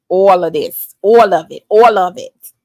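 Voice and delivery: loud, punchy attempt at a New York accent. Shown as a demonstration. Host trying out her best New York accent on livestream viewers. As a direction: hammer it out in mock New York. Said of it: "That's my best New York accent".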